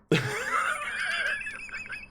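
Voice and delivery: squeaky high laugh; high giggle. Real, not acted. Laughs at own story.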